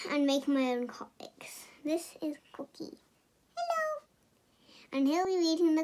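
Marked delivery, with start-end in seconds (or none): 1.8-3.0 unvoiced whisper introducing a toy; breathy secret aside; hushed intro. Real, not acted. 3.6-4.1 high squeaky puppet greeting; toy's squeaky hello. Pretend. Child voicing a toy.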